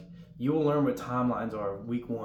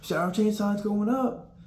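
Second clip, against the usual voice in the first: loud, slow group echo, pitched up; repeated call-out; 8 semitones higher, 3 dB louder, slower than usual. Retold or imitated. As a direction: echo it back with the whole dorm. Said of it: "Everybody repeats it in the dorm".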